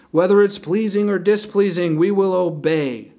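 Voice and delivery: high, loud show of devotion; flowery, buttery. Acted. Preacher mimics the people promising Jeremiah obedience, flowery and buttery. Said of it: "flowery, beautiful, buttery speech".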